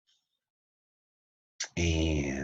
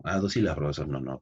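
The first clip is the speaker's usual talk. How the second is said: ordinary talk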